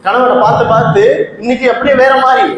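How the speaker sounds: ordinary talk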